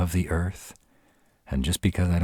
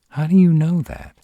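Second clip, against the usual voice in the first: high, loud burst of disbelief; startled, incredulous question; astonished; 10 dB louder than usual. Acted. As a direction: ask it amazed, pitch leaping right up. Astonished at what the listener knows.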